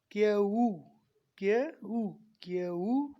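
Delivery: क्यू stretched, gliding into ऊ; demonstrated Q. Shown as a demonstration. Teacher modelling Q for students, slow stretched क्यू. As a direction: draw out क्यू in separate sounds. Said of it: "इसी तरह से".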